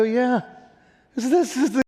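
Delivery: high, excited reply to dad; bursting to explain his job; eager yes. Retold or imitated. Son eagerly answering dad.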